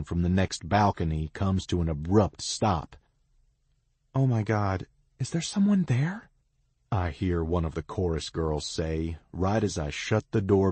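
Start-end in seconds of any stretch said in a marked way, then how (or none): 4.1-6.3 startled. A narrator's reading. Chorus girl startled.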